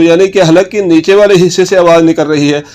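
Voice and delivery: ordinary talk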